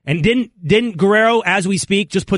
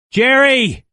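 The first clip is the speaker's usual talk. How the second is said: pleading; 5 semitones higher than usual. Real, not acted. Pleading with Cowboys owner.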